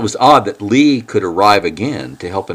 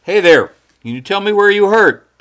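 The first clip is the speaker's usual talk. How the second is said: calling out; 13 semitones higher, 8 dB louder than usual. Acted. Lee addressing injured man.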